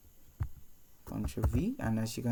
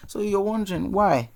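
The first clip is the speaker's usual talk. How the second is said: normal talking voice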